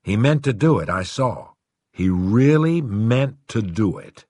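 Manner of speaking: plain reading voice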